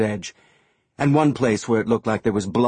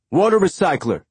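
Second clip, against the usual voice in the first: high, loud call; shouted; 8 semitones higher, 4 dB louder than usual. A narrator's reading. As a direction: shout the offer across the yard. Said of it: "Amos shouted".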